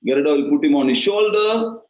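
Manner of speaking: ordinary talk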